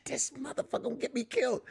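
high, hushed mock-panicked aside; mock dread. Comic dreading Shannon on the phone, hushed mock panic. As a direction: squeeze it up high and soft.

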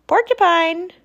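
high, loud call at the door. Acted. The friends call Porcupine at her door, loud and high.

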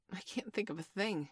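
soft, regretful admission. A narrator's reading. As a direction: give up quietly, with regret. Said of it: "he said regretfully".

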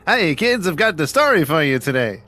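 put-on high, cheery storyteller voice for children; lightly voiced children's TV host; kids show host. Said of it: "he's trying to do a Barney type thing".

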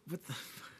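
breathless, whispered disbelief; stunned whisper. Retold or imitated. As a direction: let it slip out under your breath. Stunned after the movie.

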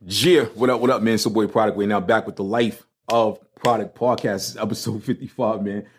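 ordinary delivery

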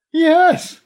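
high, loud scream of relief. Acted. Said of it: "I screamed".

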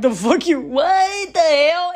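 put-on child stunned by the stranger calling himself dad; stunned outrage. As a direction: stretch out the shock. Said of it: "I'm like".

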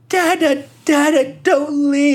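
put-on very high, drawn-out child's plea; mock tearful squeal, stretched out; crying daughter. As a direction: imitate a little girl wailing for her dad. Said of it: "Like, she's crying".

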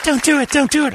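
put-on high, pleading falsetto of a girl at the pool; hammed-up high girly plea; mock girly squeal. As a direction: squeal it like a girl begging not to be thrown in. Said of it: "She goes".

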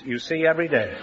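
normal talking voice

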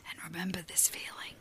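soft hushed whisper. Pretend. Guiding friends to remember a feeling, soft whisper. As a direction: let it fade into a tender whisper. Mock mindfulness, much quieter.

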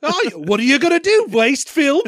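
high, lilting British maid; fake British accent. Maid lecturing the princess on marriage, fake British accent. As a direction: ham up a British servant's accent. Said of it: "She's doing a fake British accent".

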